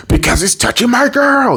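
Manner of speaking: very high-pitched mock-jealous squeal; hammed-up possessive cartoon cry; jealous outburst. Acted jealous answer about girlfriend.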